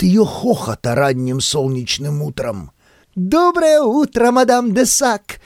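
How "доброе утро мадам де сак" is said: put-on high, slow, cheerful girl's greeting; laughing greeting. A narrator's reading. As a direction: sing out hello, laughing. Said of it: "смеялась девушка внизу".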